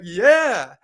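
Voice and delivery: high, loud cheer; retold jubilation. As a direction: whoop it out mid-jump. Retold joy while jumping down street.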